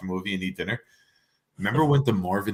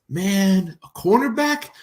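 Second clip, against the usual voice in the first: put-on much higher, louder kid voice, incredulous; imitating his son; 10 semitones higher, 5 dB louder, slower than usual. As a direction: play the let-down kid asking in disbelief. Voicing his son's reaction.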